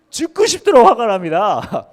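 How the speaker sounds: loud, slow, high sulky outburst; mock furious answer. Jonah answering God, hammed-up rage. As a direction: spit out the anger slowly.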